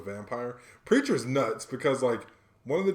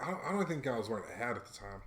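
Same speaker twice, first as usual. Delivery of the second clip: ordinary talk, nothing marked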